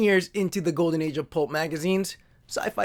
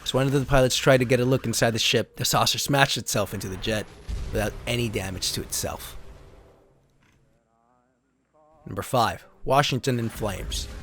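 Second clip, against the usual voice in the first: lower, steady storybook narrator voice; story narration; 8 semitones lower than usual. Acted. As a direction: read the card like an old sci-fi serial. Continues card story narration.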